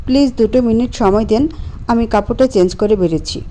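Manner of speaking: usual speaking voice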